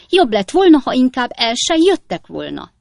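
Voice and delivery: high, loud landlady; shrill-pitched scolding; angry shout. A narrator's reading. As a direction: hurl indignation at the guests. Landlady shouts at departing guests.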